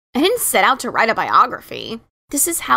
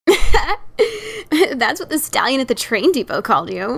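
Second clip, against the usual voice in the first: slow words broken by giggles; explaining through giggles; 2 semitones higher than usual. Acted. Sister explains the nickname while giggling. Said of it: "she explained between giggles".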